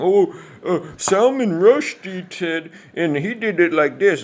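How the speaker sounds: very high, drawn-out put-on voice; slow, loud, hammed-up know-it-all imitation; mocking name-dropper. Coattail rider citing Salman Rushdie, mocking high voice. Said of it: "Coattail riding".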